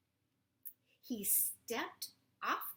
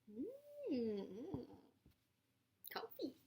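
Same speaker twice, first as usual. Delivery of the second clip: cozy high whisper; 5 semitones higher, much slower than usual. Acted. Bear on bed.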